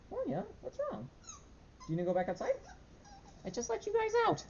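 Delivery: very high squeaky pet voice. Real, not acted. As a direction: squeak at the dogs. Talking to the dogs.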